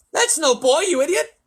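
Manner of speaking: loud, very high outburst; furious controller shouting; angry shout. A narrator's reading. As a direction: shout down a madman. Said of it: "shouted ground control".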